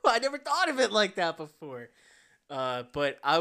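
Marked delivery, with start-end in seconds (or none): 0.1-1.9 very high, loud gullible revelation; mock amazement. Retelling his reaction to the bug pitch, mock amazement. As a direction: squeal it like a hammed-up epiphany.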